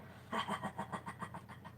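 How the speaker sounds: long breathy demo laugh; hearty laugh. Shown as a demonstration. Leading laughter yoga viewers, long breathy laugh. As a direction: laugh it all out on the exhale. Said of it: "big hearty laugh".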